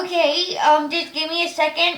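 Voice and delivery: high, slow stressed whine. Real, not acted. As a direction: sound a bit frazzled. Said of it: "I'm feeling stressed".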